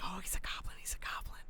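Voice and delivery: hushed imitation of onlookers whispering. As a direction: whisper it as secret gossip. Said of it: "whispering to themselves".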